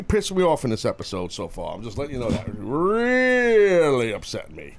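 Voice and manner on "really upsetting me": REALLY stretched long for emphasis; drawn-out REALLY. Real, not acted. Host telling how annoyed he is, REALLY stretched out long. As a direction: drag out the word Really slowly.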